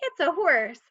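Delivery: normal talking voice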